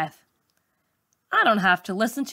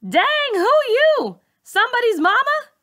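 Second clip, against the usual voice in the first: much higher, louder mocking voice; mocking teen boy; 4 dB louder than usual. A narrator's reading. Worm mocking the new teacher, high loud jeer. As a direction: sneer it out, laughing at her. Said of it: "Worm busts out laughing and says".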